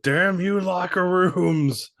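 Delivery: hammed-up despair; mock dramatic curse. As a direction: shake a fist at the sky.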